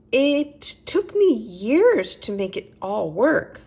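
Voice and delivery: a prim owl politely insisting all is fine; high, fluttery owl; fussy little owl. A narrator's reading.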